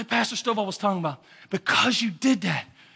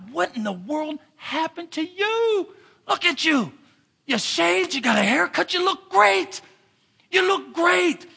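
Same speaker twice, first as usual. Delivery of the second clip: amazed friends; 9 semitones higher, slower than usual. Retold or imitated. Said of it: "his friends are looking at him like".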